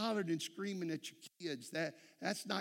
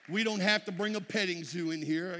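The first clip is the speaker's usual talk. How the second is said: emphatic preaching; 5 dB louder than usual. Acted. Emphatic preaching punch line.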